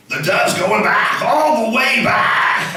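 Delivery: put-on old-school radio play-by-play, rising to a shout; baseball announcer. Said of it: "do the baseball announcer voice for the Bible".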